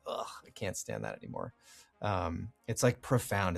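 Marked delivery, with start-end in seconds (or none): 0.1-1.5 much lower and softer, a fed-up aside; fed-up grumble. Retold or imitated. Host admitting how sick of AI phrasing he is, lower and softer. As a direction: let it fall away in disgust. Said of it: "And it's just like".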